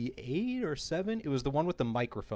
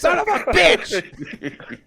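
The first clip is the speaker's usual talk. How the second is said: high, very loud, drawn-out yell; hammed-up shouted curse; imitated furious uncle; 14 dB louder, much slower than usual. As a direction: explode in mock rage at losing. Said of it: "And he's just like".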